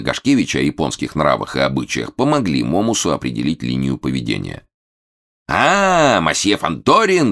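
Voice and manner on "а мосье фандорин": high, loud theatrical outcry; yelling. A narrator's reading. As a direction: bellow an indignant accusation. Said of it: "заорал Момус на косоглазого коротышку". (орёт с наигранным возмущением)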